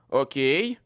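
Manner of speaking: loud shouted reply. A narrator's reading. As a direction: call out loud. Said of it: "крикнул я".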